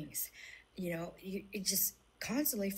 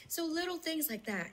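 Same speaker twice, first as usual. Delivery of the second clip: ordinary delivery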